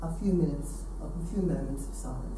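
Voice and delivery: hushed lead-in to a moment of silence; hushed invitation to silence. Real, not acted. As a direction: let the voice sink as you ask for silence.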